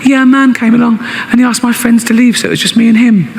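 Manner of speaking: put-on a little girl telling her dad about the park; six-year-old daughter answers her father. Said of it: "She's only six".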